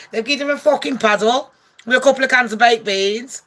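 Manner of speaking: ordinary delivery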